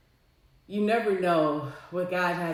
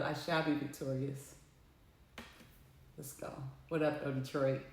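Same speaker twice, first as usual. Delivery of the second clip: much softer and lower, under the breath; quiet murmur; 7 semitones lower, 12 dB quieter than usual. Shown as a demonstration. Alone after the call, repeating the vow softly to herself. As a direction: say it to yourself, barely audible. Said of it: "But I can".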